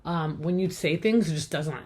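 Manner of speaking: ordinary talk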